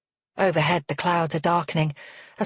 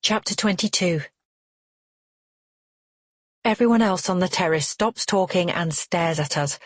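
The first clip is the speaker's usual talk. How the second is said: usual speaking voice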